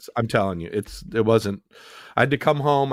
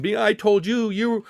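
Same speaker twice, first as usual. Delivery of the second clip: crying wife; 8 semitones higher than usual. Retold or imitated. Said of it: "she kept saying".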